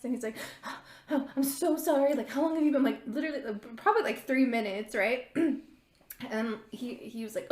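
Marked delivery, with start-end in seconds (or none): 0.6-2.8 imitating a winded, apologetic date; gasp, then a higher-pitched hurried apology; acted out of breath. Date arrives sprinting, gasps an apology.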